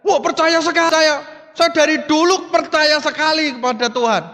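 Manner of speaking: high, insistent mock pleading; eager assurance. Dangling man swears his faith to God, acted eager assurance.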